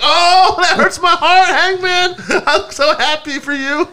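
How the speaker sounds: high, laughing mock sob; laughing mock tears. Host retells his own mock tearful reaction, high, laughing. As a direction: pretend to cry while laughing.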